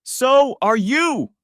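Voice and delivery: high, very loud, very slow roar. Acted. Husband roaring at his pregnant wife, enraged. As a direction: bellow each word at her. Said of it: "I roared".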